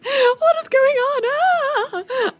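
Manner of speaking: high, alarmed outcry; startled cry. Acted. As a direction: yelp it out in shock. Startled cry at sudden scene.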